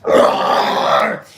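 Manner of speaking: low rasped grunt; pit grunt. Retold or imitated. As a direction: grunt like you just got shoved. Getting knocked around in pit.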